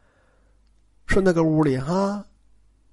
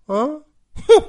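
scornful snort; 7 semitones higher than usual. Acted. Third sister scoffing at Jia Lian.

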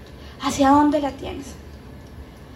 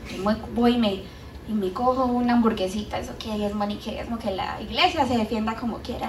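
lower, softer careless grumble; mock muttering; 4 semitones lower, 9 dB quieter than usual. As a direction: shrug it off under your breath. Voice against tithing. (burla en voz baja, más grave y suave)

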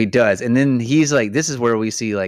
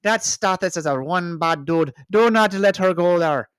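high, loud Irish baboon; hammy Irish pal giving a warning; Irish accent; 11 semitones higher, 5 dB louder than usual. Pretend. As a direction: pitch it way up in a stage Irish accent. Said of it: "That's the Irish".

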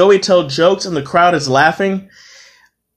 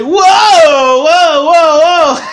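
very high, loud, drawn-out whoas; mock alarmed shout; 12 semitones higher, 6 dB louder, much slower than usual. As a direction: imitate startled coworkers, shouting way up high. Acting out coworkers' alarmed reaction.

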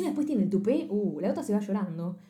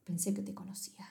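whispered, much softer quote; hushed quote; 2 semitones lower, 12 dB quieter than usual. Retold or imitated. Friend retelling the husband's heartbroken line, whispered. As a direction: breathe the words out in a whisper.

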